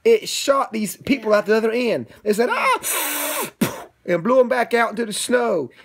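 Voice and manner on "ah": a sudden high-pitched yelp; high squeal. Acted. Shot out into the snow, a high squeal. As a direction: squeal as you fly out.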